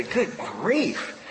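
a touch of exasperated sigh; hint of a sighed good grief. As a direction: say it like a weary grumble. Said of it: "you will hear people say".

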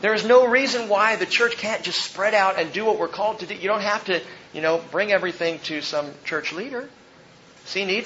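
ordinary talk, nothing marked

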